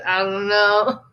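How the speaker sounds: mock-dim, level non-answer; clueless shrug. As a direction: answer like a clueless husband, lightly acted. Acted clueless Othello answering host.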